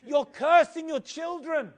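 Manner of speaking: alarmed rebuke. Retold or imitated. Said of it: "He stands up and says".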